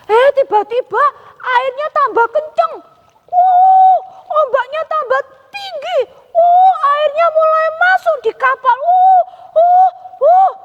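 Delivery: high, loud excited "wow" as the waves rise; storm excitement. A narrator's reading. Telling children the storm rises, loud excited exclaims. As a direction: build alarm as water pours in. Storm hits the boat in story.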